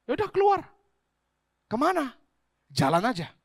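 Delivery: mock-casual retelling of Abraham's call; lively mock dialogue. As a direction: toss off the lines like a short back-and-forth. Acts out God's call to Abraham.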